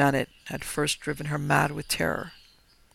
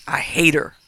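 a louder, hard-edged declaration of hate; hatred; 7 dB louder than usual. Acted. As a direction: spit it out with loathing. Jerry declares hatred for wife.

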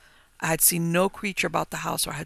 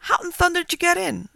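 astonished; 12 semitones higher, 4 dB louder than usual. Acted. Farmer astonished at haunted house.

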